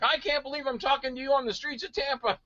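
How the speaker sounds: high-pitched gushing disbelief, imitated; mock amazed fan. Fan meeting the actor downtown, amazed. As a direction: gush it like an awestruck fan.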